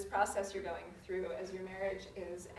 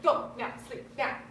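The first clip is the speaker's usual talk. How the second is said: loud, higher, word by word orders; frustrated mom orders her three-year-old down; clipped commands; 5 semitones higher, 11 dB louder, slower than usual. Retold or imitated. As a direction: bark each word at a toddler. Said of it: "It's like".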